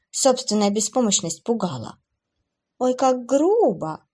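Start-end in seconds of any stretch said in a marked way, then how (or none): 2.8-4.1 slow, drawn-out fake hurt; mock offense. Demoness mocking Abby's insult with mock offense. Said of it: "поморщилась Севиль".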